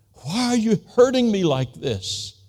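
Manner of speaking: a man pleading with God in pain; crying out to God. Retold or imitated. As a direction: cry it up to heaven, hurt and lost. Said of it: "crying out".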